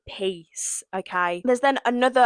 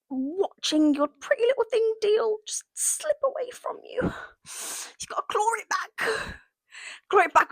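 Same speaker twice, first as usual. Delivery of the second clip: dramatic whisper; 12 semitones higher, 4 dB quieter than usual. Acted. Whispered aside to Megan.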